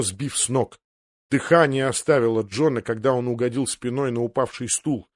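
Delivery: usual speaking voice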